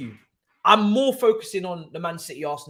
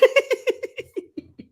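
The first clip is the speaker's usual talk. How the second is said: shrieking laughter; real uncontrollable laugh; high hysterical laugh. Real, not acted. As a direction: let out a high helpless cackle.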